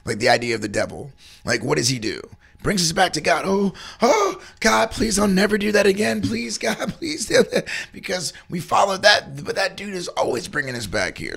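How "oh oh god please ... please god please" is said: high, pleading mock prayer; mock begging. A sinner pleading with God, hammed-up and high. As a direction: beg God like a sorry sinner.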